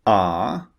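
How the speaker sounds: ah held long and open; long ah vowel. Shown as a demonstration. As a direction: model the long Ah sound. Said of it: "two vowel sounds we're going to focus on".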